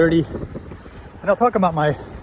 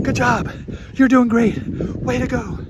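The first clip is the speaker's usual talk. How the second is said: higher, bright encouraging cheer; cheering on racers; 8 semitones higher than usual. Retold or imitated. Racer cheering on fellow triathletes, upbeat encouraging call. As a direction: call out to passing racers like a friendly fan. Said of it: "the guy that would cheer people on".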